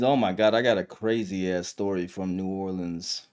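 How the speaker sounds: ordinary talk, nothing marked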